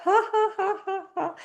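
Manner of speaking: very high, light laughter; pouring laugh. Shown as a demonstration. Pouring it up for the class, high laugh. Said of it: "with laughing".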